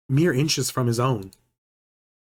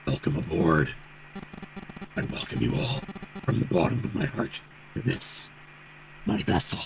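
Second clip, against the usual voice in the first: low, half-whispered sinister voice; cold eerie voice; 4 semitones lower, 7 dB quieter than usual. Acted. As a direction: speak low and cold, like a menacing captain. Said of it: "a cold, eerie voice".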